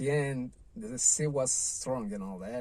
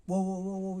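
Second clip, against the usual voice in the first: mock screaming; 7 semitones higher than usual. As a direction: mimic a lifeguard's nagging holler, half-strength. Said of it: "nobody screaming in your head".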